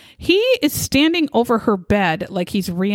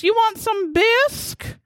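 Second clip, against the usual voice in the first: mock breezy husband; 11 semitones higher than usual. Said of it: "he says".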